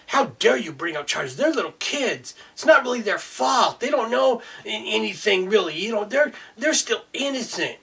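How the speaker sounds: high, whiny mock of a scolding judge. Ranting man mocks the judge scolding the parents, high and whiny.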